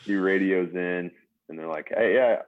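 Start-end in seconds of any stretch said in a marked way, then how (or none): 1.9-2.4 put-on high brash New York accent; loud New York guard voice. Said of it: "there's a New York accent".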